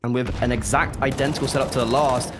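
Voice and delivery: ordinary delivery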